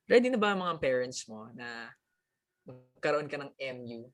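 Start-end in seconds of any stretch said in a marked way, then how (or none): none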